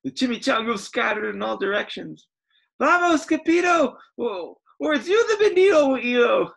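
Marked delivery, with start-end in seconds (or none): 2.8-6.5 high, loud panicked call; frightened dogs shout a warning; cried out. Acted. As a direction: yell the warning to flee. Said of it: "they cried".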